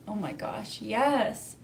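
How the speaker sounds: ordinary delivery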